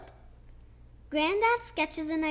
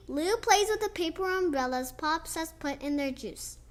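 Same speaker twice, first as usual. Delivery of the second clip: usual speaking voice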